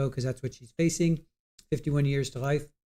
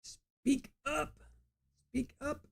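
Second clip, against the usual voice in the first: retold frustration at the screen; mock scream; 12 semitones higher, 10 dB quieter, much slower than usual. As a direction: squeal it like yelling at a screen. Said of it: "I was screaming through my computer".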